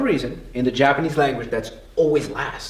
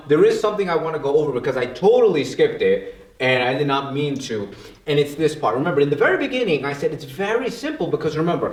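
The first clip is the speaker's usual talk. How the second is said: ordinary talk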